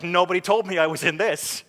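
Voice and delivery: astonished protest. Retold or imitated. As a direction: exclaim it as if comically left out. Retells his astonishment at trailer.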